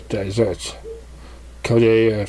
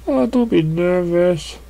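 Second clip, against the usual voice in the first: don't be nervous; 7 semitones higher, slower than usual. A narrator's reading. Pinkie reassures Fluttershy.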